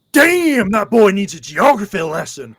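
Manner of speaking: drawn-out, high, booming exclamation; loud bystander impression. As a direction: holler it out in mock astonishment. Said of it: "this guy literally goes".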